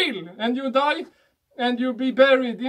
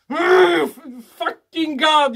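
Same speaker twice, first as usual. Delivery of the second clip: slow, loud mock curse at God; 3 semitones higher, 6 dB louder, much slower than usual. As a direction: spit out a slow, hammed-up oath. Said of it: "you say".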